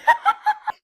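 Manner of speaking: high, loud cackle burst; short, high-pitched peal of laughter. Real, not acted. Laughs after her own outburst.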